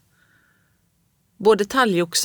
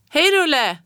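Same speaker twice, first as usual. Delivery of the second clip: calling out; 9 semitones higher than usual. A narrator's reading. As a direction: hail a friend from the street. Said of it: "ropade Backesbergspojkarna".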